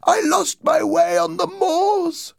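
slow, very high, loud wail; crying ghost voice. A narrator's reading. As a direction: wail it out like a lost soul. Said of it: "the voice cried".

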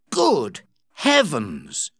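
slow, drawn-out exclamation of surprise; astonished cry. A narrator's reading. Joss as the woman unmasks, astonished cry. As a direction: cry out slowly, word by word. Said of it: "cried Joss".

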